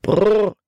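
high, loud rolled "brrr"; horse-halting brrr. A narrator's reading. Driver reining in the horses, loud trilled brrr.

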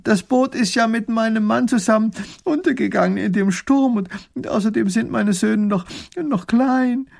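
high, halting, loud lament; sobbing. A narrator's reading. Widow explains her loss to the princess, sobbing. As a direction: wail through tears, faltering. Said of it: "fängt wieder an zu schluchzen".

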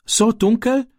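high, scared stammer; a small boy frightened of the dark. Acted. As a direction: Ram stammering in fear. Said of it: "stammelte Ram".

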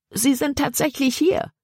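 high-pitched exclamation without extra volume; stifled scream. A narrator's reading. Libby longs to scream that they are here, high and contained. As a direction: exclaim it high, holding back the shout. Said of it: "hätte am liebsten geschrien".

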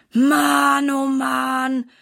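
loud groaning Mann oh Mann; boy groans over the laundry pile, loud groan. A narrator's reading. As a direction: groan it out, worn down by chores. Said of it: "stöhnt Silas".

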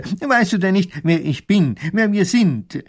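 höher, stockend mit vielen Ähs; zerstreuter Professor. Acted. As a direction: ein verwirrter alter Gelehrter sucht die Worte. Professor fragt den Soldaten. (zerstreuter Professor, höher und stockend)

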